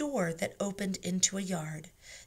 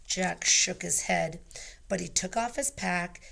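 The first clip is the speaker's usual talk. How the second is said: usual speaking voice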